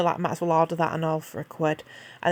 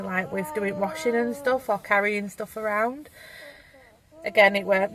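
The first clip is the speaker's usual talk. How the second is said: usual speaking voice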